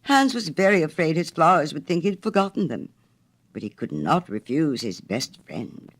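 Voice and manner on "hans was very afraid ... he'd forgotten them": ordinary delivery, nothing marked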